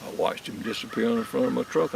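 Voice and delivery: normal talking voice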